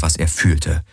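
ordinary delivery

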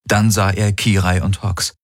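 ordinary delivery, nothing marked

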